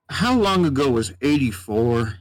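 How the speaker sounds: lightly voiced puzzled old cowboy; a hint of a cowboy who has lost track of the years. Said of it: "he just was very much cowboy sounding".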